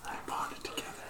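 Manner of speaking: unvoiced, soft whisper. Real, not acted. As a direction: breathe it out quietly. Whispered aside.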